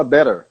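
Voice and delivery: better with the T turned into a tapped R; American flapped T. Shown as a demonstration. Teacher imitating an American, "better" with a flapped T. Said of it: "O T vira R".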